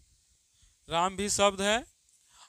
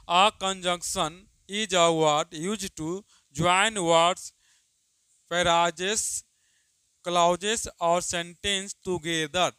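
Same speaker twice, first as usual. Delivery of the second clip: louder, each phrase spaced out; loud slow dictation; 5 dB louder, much slower than usual. Shown as a demonstration. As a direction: dictate the definition to the class. Dictates the definition aloud. (ज़ोर से, धीरे-धीरे लिखवाना)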